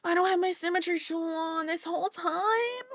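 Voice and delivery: high, dismayed exclamation; surprised realization. Real, not acted. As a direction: suddenly catch your own mistake. Realizes a mistake mid-drawing.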